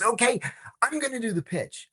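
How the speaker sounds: high, keen announcement; eager boss. Retold or imitated. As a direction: grab the job with excitement. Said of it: "He goes".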